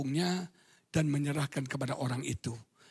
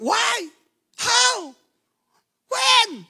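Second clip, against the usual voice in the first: put-on very high, loud complaining kid; child talking back to a parent, shrill and loud; whiny child; 8 dB louder, much slower than usual. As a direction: protest like a bratty child. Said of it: "Anak-anak sudah jawab".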